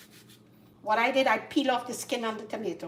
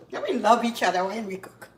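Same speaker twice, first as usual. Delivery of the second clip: normal talking voice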